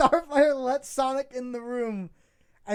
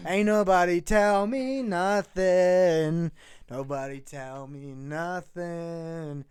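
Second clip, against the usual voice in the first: low slow cowboy drawl; 10 semitones lower, 4 dB quieter, slower than usual. Acted.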